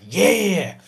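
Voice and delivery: loud, taunting gloat; loud mocking victory taunt; gloating bear. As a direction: rub it in, loudly. Bear gloating over you.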